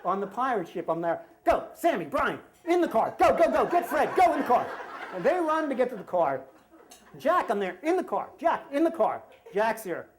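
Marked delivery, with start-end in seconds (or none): 1.4-4.6 high, loud re-enacted panic; urgent shouting. Dad hurries kids off pirate ship, urgent acted shouting. 7.8-9.2 urgent calling. Retold or imitated. Fleeing dad orders son to car.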